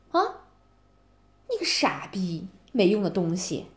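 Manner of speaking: usual speaking voice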